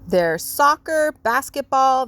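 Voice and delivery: Sister's teasing list. Acted. Sister listing distractions.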